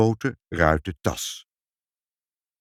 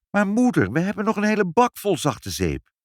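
high, louder protest to mother; astonished exclaiming; 12 semitones higher, 3 dB louder than usual. A narrator's reading. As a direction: call out in amazement, pitch way up. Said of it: "keken heel verbaasd en riepen". (verbaasd uitroepend, hoog)

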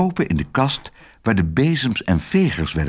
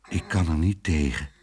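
sighing; 6 semitones lower, 5 dB quieter than usual. A narrator's reading. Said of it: "zuchtte hij".

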